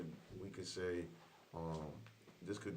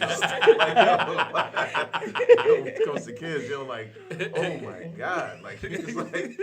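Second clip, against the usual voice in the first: laughing; 10 semitones higher, 19 dB louder, much slower than usual. Real, not acted. Laughing hard about kids.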